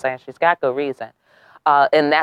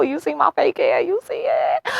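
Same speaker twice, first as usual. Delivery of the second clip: playful high boast; 10 semitones higher than usual. Real, not acted. Showing off her fake hair.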